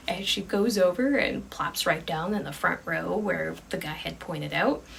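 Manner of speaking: ordinary delivery, nothing marked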